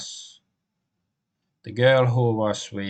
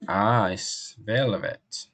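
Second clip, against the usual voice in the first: ordinary delivery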